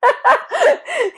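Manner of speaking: loud amused laugh; high burst of laughter. Real, not acted. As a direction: crack up at the slip. Laughs at own slip.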